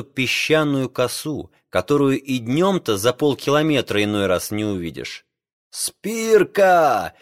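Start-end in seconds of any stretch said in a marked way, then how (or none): 5.7-7.1 high calling shout; captain's shout. A narrator's reading. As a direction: call out across the deck. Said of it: "крикнул капитан греку".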